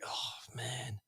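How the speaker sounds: breathy whisper of defeat; hushed, deflated "oh, man" whisper; retold whispered dismay. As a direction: whisper it like your heart just sank. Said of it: "And I'm like".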